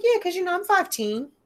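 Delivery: high mock teen boast; mimicking daughter. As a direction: play the smug teenage daughter.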